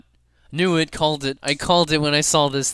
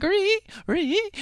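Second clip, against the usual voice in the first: high screechy horror-music squeal; imitated scary music. Imitating the bar's terrifying music, high screechy squeal. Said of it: "It's making this scary noise".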